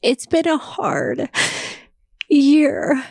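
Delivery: high, slow, tear-choked words; breaking up over a hard year; crying. Real, not acted. Missing Dave while talking to viewers, crying. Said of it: "after I got emotional".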